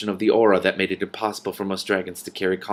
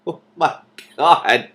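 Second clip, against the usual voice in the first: louder, higher exclamation; amazed; 6 semitones higher, 7 dB louder, slower than usual. Real, not acted. Reader reacting to the dragon's harsh words, exclaiming. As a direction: react in mock shock.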